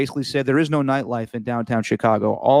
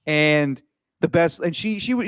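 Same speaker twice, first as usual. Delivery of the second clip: ordinary talk, nothing marked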